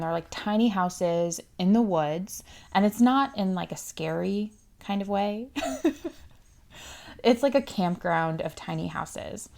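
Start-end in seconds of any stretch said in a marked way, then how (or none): 5.5-6.1 short, higher-pitched little laugh; light chuckle. Real, not acted. Host clarifies the woods aren't scary, light self-amused chuckle. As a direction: chuckle briefly at your own aside.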